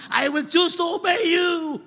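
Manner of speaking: high, loud declaration of obedience; shouted vow. Acted. Preacher voices a believer's vow to God, shouted. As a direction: proclaim it at the top of the register.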